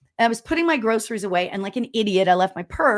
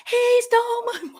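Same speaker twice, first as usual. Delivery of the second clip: much higher shouted call; retold yell. Chasing the thief through the store, shouting. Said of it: "I'm like".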